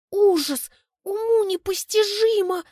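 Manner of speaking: high, loud squeal of horror; a theatrical gasp of horror; shocked outcry. Acted. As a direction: cry out in disgusted shock. Girl shocked by a cow.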